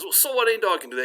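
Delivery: high-pitched scoffing objection; indignant joke-character protest; dismissive bartender. Acted. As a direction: brush it off, pitch way up. Unimpressed bartender argues back.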